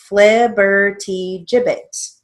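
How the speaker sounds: flibbertigibbet said slowly, each part apart; slow demo pronunciation. Shown as a demonstration. Teacher sounding out flibbertigibbet slowly for spelling. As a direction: stretch out flibbertigibbet syllable by syllable. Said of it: "writing it phonetically".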